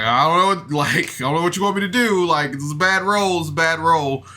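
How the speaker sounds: mock fed-up player, half laughing; exasperated Cody. Player venting about a bad role, mock exasperated. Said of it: "you could feel like Cody was just like".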